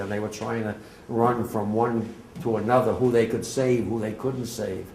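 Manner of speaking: normal talking voice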